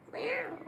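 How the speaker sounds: high mock meow. Imitating a cat to her cat, a high playful meow.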